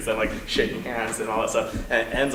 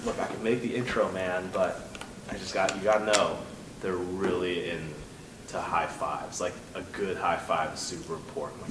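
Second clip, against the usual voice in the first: low confiding advice; 5 semitones lower, 7 dB quieter than usual. Pretend. Liddell quietly advising.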